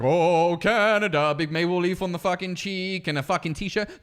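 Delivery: hammed-up flag-waver, easing into a rant; mimicking an over-proud patriot; mock patriotism. Mimicking Canada Day flag-wavers.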